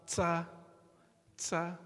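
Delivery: "tsa" with the tongue on the teeth, a hissing ts; wrong ts sound. Shown as a demonstration. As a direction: let the tongue touch the teeth so "tsa" hisses. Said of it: "If it touches the teeth, you have this sound".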